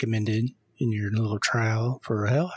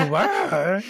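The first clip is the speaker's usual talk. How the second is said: cartoon dog voice; 9 semitones higher, 4 dB louder than usual. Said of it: "That was perfect".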